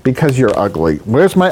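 ordinary talk, nothing marked

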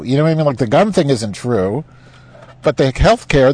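usual speaking voice

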